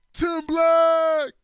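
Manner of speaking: high, loud drawn-out name call; loud greeting. Retold or imitated. Friend greeting Tim across the room, shouting his name.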